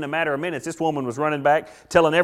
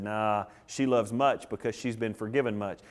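soft, lower, calm answer; gentle low reply; 8 semitones lower, 9 dB quieter than usual. Acted. Jesus gently answering the critics, low and soft. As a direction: say it softly, settling the matter.

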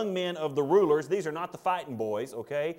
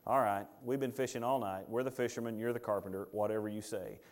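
weary fishermen; 6 semitones lower, 7 dB quieter than usual. Acted. Fishermen answering Jesus.

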